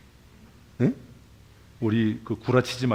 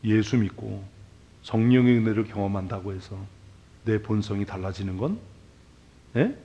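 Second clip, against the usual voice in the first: ordinary talk, nothing marked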